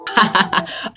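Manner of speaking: high breathy giggle; imitated laugh. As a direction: giggle like him. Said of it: "that's his laugh".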